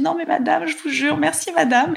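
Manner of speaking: very high, breathless rush of gratitude; gushing thanks. Retold or imitated. To the prosecutor's secretary, giddy high-pitched thanks. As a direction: squeal out thanks as if the weight just lifted.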